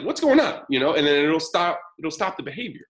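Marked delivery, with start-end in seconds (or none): 0.0-0.6 high, startled exclamation; startled reaction. Retold or imitated. Yelled-at listener reacting, startled. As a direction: jolt into a higher pitch. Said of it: "if I just yell at you".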